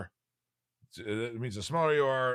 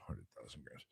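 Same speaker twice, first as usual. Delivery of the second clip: whisper; 9 semitones lower, 16 dB quieter than usual. Real, not acted. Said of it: "whispered aside repeating figure".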